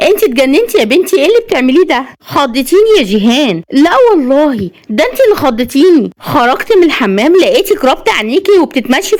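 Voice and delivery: put-on lively, lifted girlfriend voices; startled friends bantering. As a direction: play two friends teasing after a scare.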